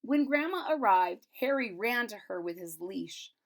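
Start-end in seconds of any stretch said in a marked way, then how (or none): none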